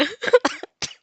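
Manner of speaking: sudden high laugh at her own slip; high burst of laughter. Real, not acted. Narrator laughing at her own Azula mistake. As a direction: break into a real high laugh.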